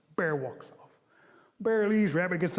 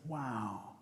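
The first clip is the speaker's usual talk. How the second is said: low, hushed wonder; quiet amazement; 7 semitones lower, 9 dB quieter than usual. Acted. Rabbit sees the feather fly across the river, hushed amazement. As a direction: say it softly, awed. Rabbit awed by far shot.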